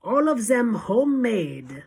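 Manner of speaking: high, loud wicked relish; fiendish leer. A narrator's reading. Witch leader sharing her joke, fiendish gloating. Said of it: "added with a fiendish leer".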